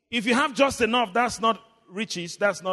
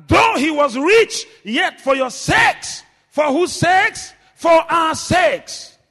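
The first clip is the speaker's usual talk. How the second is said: loud, higher, drawn-out sermon delivery; forceful preaching; 8 semitones higher, 9 dB louder, slower than usual. Real, not acted. Preacher reading scripture to the congregation, forceful preaching. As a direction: proclaim the verse to the congregation.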